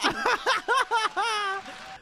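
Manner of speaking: squealing derisive laugh; high scornful laugh. Real, not acted. Mocking the activist's speech, high scornful laugh. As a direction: laugh in disbelief at her.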